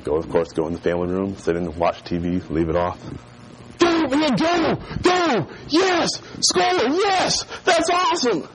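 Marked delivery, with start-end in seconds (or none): 3.8-8.5 high-pitched mock sports cheering; excited yelling. Boy faking a TV game to fool his brother, excited yelling. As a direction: cheer as if the team scores. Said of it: "Anton hears me yelling".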